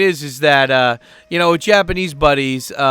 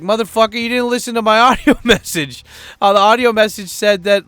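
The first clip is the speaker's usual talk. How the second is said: high-pitched exasperated outburst; pissed off; 7 semitones higher, 4 dB louder than usual. Retold or imitated. To his buddy who missed the audio message, pissed off. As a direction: retell it as an indignant, worked-up protest. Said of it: "I was so pissed off".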